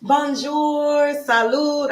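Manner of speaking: high, cheerful Bonjour, salud; bright French greeting. Real, not acted. Host greeting viewers in French, cheerful. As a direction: greet the chat warmly in French.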